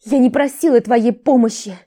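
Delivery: loud, high anguished moan; groaning. Acted. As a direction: moan it out, exhausted. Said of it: "застонала она".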